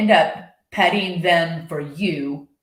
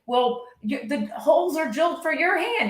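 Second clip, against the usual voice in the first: higher mock Marge objecting; 10 semitones higher than usual. Marge pointing out the ball fits Homer's hand. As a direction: object in a put-on wife voice. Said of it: "It's like".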